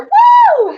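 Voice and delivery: loud, high drawn-out "woo"; whooping cheer. Real, not acted. Host cheering a viewer's jab, loud whoop. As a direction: let out a big celebratory whoop.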